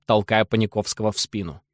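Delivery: plain reading voice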